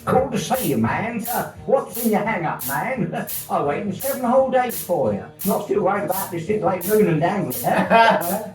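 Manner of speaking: high Cockney greeting with a laugh; lightly voiced cheerful Cockney; cockney accent. Mad John greeting Stan in a high Cockney voice. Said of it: "a voice full of the cockney".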